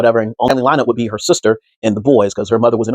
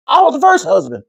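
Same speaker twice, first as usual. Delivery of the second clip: a higher, earnest Ike saying his line; mock Ike answer. Ike telling mourners who he is, mock earnest. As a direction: play it dead straight like Ike.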